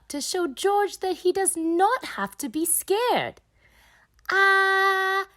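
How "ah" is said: say ah. Acted. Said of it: "says Peppa".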